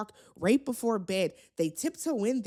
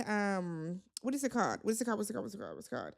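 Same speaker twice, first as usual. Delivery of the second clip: soft, fading mutter while searching memory; trying to jog your memory under your breath; muttering to oneself; 3 semitones lower, 6 dB quieter, much faster than usual. Real, not acted. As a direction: mumble it repeatedly, trailing almost to nothing. Searching for a forgotten name.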